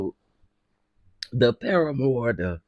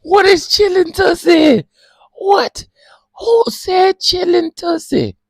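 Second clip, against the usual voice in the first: very high, loud shriek; very high, loud and incredulous; shrieking disbelief; 8 dB louder, slower than usual. Real, not acted. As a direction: yell the question in shock. Shouting the name in disbelief.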